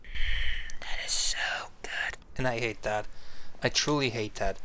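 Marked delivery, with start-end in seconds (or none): 0.0-2.2 whispered parody of savoring food; hum, then whisper like an ASMR eater relishing it; mock ASMR whisper. Streamer mocking an ASMR eater tasting food, in a whisper. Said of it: "A S M R people eating loud into microphone".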